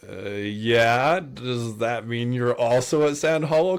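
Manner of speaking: drawn-out, rising hesitant answer; wary reply. Retold or imitated. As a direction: stretch it slowly into a suspicious question. Retold wary reply to client.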